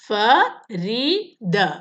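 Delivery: Farida stretched out in three beats; Farida said very slowly, syllables apart. Shown as a demonstration. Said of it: "വേർഡിനെ നമ്മൾ different syllable ആയിട്ട്".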